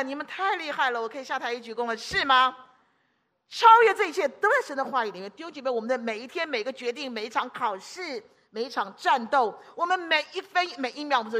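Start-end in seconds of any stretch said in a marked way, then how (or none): 3.5-5.3 louder declaration, 在 stressed; proclaiming. Real, not acted. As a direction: announce it boldly.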